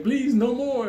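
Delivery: high, loud whimpering plea; mock pleading. Big man begging the narrator to stop, mock plea. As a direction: beg for mercy like a beaten man. Beaten man begs for mercy.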